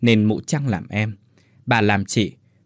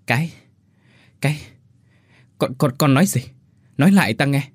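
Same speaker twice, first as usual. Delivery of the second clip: stammering in shock; 3 semitones higher, faster than usual. Acted. Shocked lady stammering at the boy, then demanding. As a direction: trip over the first words, then push. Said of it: "Bà lắp bắp hỏi lại".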